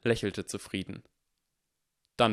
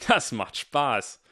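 slow, louder gloating; gloating laugh; 5 semitones higher, 5 dB louder, much slower than usual. Acted. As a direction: relish the fun of bullying. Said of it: "Derek lachte". (hämisch lachend, langsam)

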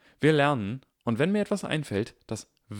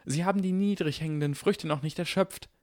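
high, urgent persuasion; excited urging; 9 semitones higher than usual. Acted. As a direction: push hard, eager.

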